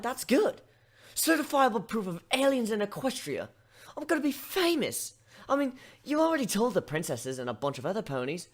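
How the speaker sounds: put-on a young pony thrilled to be famous; lightly voiced giddy pony; excited pony voice. Pony excited about photo.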